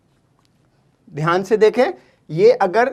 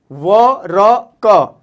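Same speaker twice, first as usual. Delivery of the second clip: very slow, louder व र क with each sound apart; syllable by syllable; 4 dB louder, much slower than usual. Shown as a demonstration. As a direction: break वरक into its sounds. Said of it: "सबके बीच में अ की sound आ रही है".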